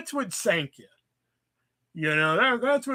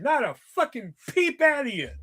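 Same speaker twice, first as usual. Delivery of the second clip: usual speaking voice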